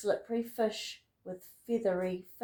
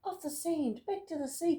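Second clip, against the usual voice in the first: higher, urgent plea; urgent cry; 7 semitones higher than usual. Acted. Snail crying out urgently to the beached whale. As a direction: hurry him back to the water. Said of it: "cried the snail".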